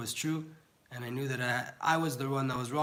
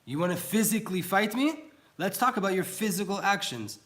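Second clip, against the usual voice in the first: higher, louder, punchy challenge; indignant acted retort, pitched up; confrontational challenge; 6 semitones higher, 5 dB louder than usual. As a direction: throw it out like a dare to fight.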